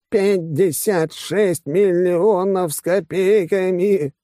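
high, drawn-out, juddering words; shaken while speaking. A narrator's reading. Financier shaken by his chief, forcing words through chattering teeth. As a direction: speak as if being rattled by the shoulders. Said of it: "Очень трудно говорить, когда тебя трясут".

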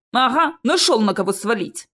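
ordinary delivery, nothing marked

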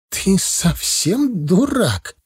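high, drawn-out scolding; indignant exclamation. A narrator's reading. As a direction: stretch out the insult in outrage. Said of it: "воскликнула первая".

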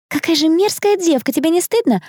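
high, outraged reproach; scandalised scolding. A narrator's reading. Onlooker shaming accused girl, outraged scolding.

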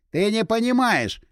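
high, drawn-out «не понимаешь»; heated protest. A narrator's reading. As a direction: protest insistently, pitched high and slow on «понимаешь». Heated quarrel, insisting he understands better. (взвинченно, высоко и протяжно: «не понимаешь»)

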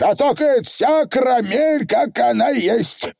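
high, bold Feegle declaration; high triumphant pixie; defiant Feegle. Acted. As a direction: throw down the pencil proudly.

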